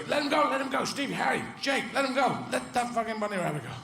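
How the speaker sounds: high, urgent call to the pack; high-voiced dog stopping the chase; bright greyhound calling out. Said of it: "one dog bright enough to go".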